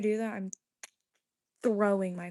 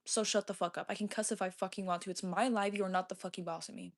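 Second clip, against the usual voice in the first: usual speaking voice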